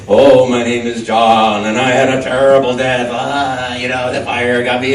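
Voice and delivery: hammy, drawn-out theatrical recitation; young actor's monologue. Acted. Young actor reciting a Spoon River monologue, overblown and loud. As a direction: declaim it slowly with sing-song melodrama. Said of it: "this is like young actor stuff".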